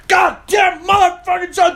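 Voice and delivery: imitating a coworker blowing up at work; mock furious swearing. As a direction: explode like the hothead coworker.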